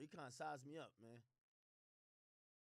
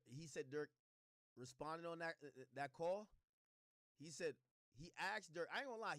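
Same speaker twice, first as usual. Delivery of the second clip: ordinary talk, nothing marked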